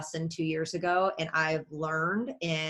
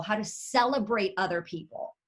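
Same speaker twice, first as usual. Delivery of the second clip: normal talking voice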